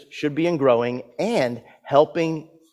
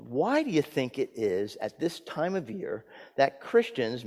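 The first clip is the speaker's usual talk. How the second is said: normal talking voice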